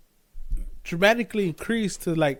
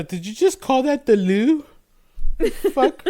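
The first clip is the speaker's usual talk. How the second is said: mock shocked parent; 7 semitones higher, 5 dB louder than usual. Parent shocked at British-sounding kid.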